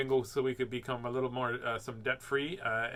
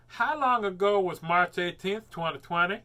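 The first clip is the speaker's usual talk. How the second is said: slow, loud, spelled-out query; slow clear question; 5 semitones higher, 7 dB louder than usual. Real, not acted. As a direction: speak slowly for the phone. Asking Siri slowly.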